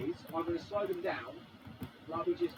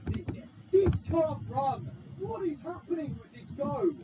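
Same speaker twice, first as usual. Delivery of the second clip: exasperated disbelief; 7 semitones higher, 6 dB louder than usual. Real, not acted. Player baffled by the chaotic race, loud exasperated disbelief. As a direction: cry out in disbelief, stretching the words.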